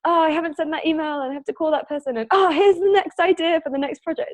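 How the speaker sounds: high, hurried inner monologue; racing thoughts. Retold or imitated. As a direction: mimic a busy mind jumping between tasks. Voicing an entrepreneur's racing thoughts.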